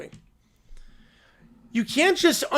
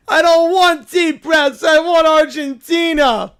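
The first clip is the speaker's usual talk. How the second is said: put-on slow, louder kid tantrum yell; mock tantrum shout; 7 semitones higher, 10 dB louder, slower than usual. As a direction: scream it like a toddler meltdown. Caillou throws a tantrum.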